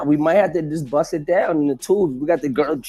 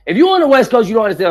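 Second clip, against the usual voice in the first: raised high call to the West Coast; pitched-up callout; 6 semitones higher, 4 dB louder, faster than usual. Real, not acted. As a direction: shout it out to the listeners. Calling out to the audience.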